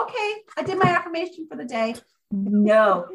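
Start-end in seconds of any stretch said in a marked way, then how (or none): 0.0-2.0 careless box-ticking. Pretend. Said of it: "don't pull the card out and read and go".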